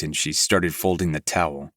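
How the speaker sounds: ordinary delivery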